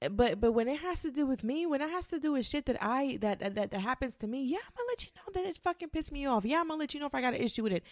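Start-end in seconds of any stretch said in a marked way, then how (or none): 4.4-7.8 higher, fast worked-up rant; heated. Real, not acted. Ranting to viewers about speaking up, high and fast. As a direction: let the words tumble out fired up.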